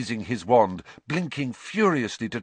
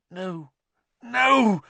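gasped; 9 semitones higher, 5 dB louder than usual. A narrator's reading. Harry as the Dementor reaches past him, gasped. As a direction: gasp it, rising to a loud protest. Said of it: "Harry gasped".

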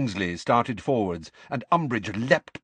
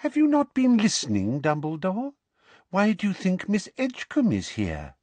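Umbridge voice; 10 semitones higher than usual. Acted. Umbridge lecturing Dumbledore.

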